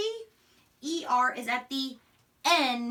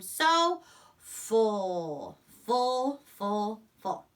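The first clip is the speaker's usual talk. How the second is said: drawn-out I am so full, stuffed; slow, satisfied full; 2 semitones lower, much slower than usual. Shown as a demonstration. Teacher acting out being full after dinner. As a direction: stretch it out like you've eaten too much. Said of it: "After I eat dinner".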